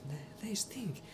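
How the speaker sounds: whispering. Acted. GM whispers a smelly detail.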